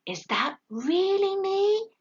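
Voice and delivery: high, slow, wondering voice; can't believe it; amazed owlet. A narrator's reading. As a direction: owlet seeing his portrait, amazed.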